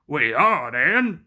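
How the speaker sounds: low, stubborn engine; defiant. A narrator's reading. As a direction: say it under his breath. Gordon defiant.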